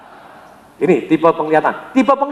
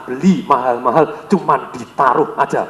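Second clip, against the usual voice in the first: much lower and slow, weighty; feeling-type language; 7 semitones lower, slower than usual. Shown as a demonstration. Trainer echoing mom's feeling-type phrase, low slow. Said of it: "Bahasa perasaan".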